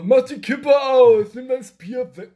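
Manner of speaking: high, loud and slow teasing yell; mock shout. Friends yelling in the background while a parent calls. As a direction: bellow it from behind your buddy.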